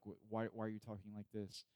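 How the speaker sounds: ordinary delivery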